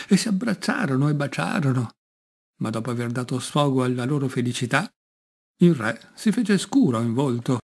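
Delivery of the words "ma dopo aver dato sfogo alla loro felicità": ordinary delivery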